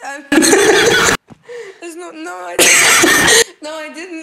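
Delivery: high, loud words broken by laughter; laughing denial. Real, not acted. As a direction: deny it while helpless with laughter. Cracking up at absurd question.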